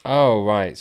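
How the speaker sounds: ordinary talk, nothing marked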